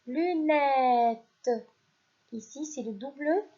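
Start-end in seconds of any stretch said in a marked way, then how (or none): 0.1-1.5 teacher dictating "lunettes"; dictation voice. Shown as a demonstration. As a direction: call out "lunettes" for the class to write. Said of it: "Je répète".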